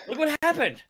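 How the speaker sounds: high, pained exclamation; mock lament. Real, not acted. Podcaster blaming himself for his prediction, high mock lament. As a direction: wail it in mock despair.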